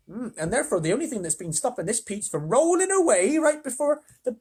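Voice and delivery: lightly high, gentle lady voice; kindly ladybird. Acted. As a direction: explain it sweetly as the ladybird. Ladybird figure voice.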